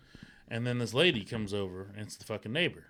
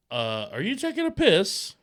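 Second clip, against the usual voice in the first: higher, louder, slowed disbelief; incredulous husband; 7 semitones higher, 5 dB louder, slower than usual. Retold or imitated. Husband asks the pushy neighbor, mock incredulous.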